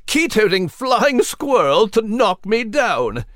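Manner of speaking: jolly shout. Acted. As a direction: shout it with glee at full lungs. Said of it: "with a jolly chuckle at the top of his lungs".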